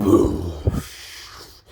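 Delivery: a breathy, unvoiced boosh blast; explosion sound. Narrator imitating the androids' explosion, unvoiced boosh. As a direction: puff out a big explosion noise. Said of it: "And when I say boosh".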